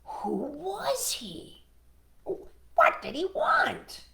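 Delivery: high, very slow questions; wondering storybook animals; crying out in bafflement. Acted. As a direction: puzzle over it, stretching each word. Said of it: "the animals cried".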